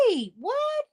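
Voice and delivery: sudden high, stunned "wait, what"; retelling the moment she heard about a new brother; shocked. Retold or imitated. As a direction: blurt it out in disbelief. Shocked reaction to news.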